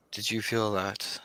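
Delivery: a touch of a hushed, uneasy queen. Acted. Bright Queen senses something.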